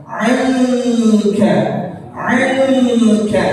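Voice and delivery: "'Anka" with a humming nasal held two counts; nasal ikhfa demo. Shown as a demonstration. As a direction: let the n hum through the nose before k. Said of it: "gunnah dengung dua harokat".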